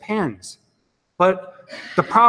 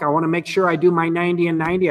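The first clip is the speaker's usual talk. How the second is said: normal talking voice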